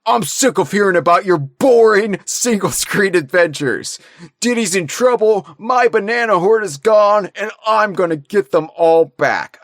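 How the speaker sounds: angry. Acted. Donkey Kong confronts Cranky.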